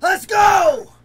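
loud triumphant yell; victory shout. Real, not acted. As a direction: celebrate the finished set. Celebrating finishing fifty.

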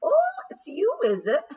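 high, loud Scottish pixie squawk; brash Scots challenge, loud and high. A narrator's reading. Rob Anybody glaring up at the Queen, Scottish challenge. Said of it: "glaring up at the Queen".

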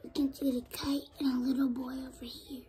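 quick whispered comment to the camera; whispered aside. Real, not acted. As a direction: rush it out in a whisper. Child whispers aside while reading.

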